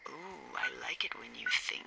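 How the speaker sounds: soft, low, whispery tease; teasing purr. Acted. Tipsy woman teases her radio partner, in a low half-whisper.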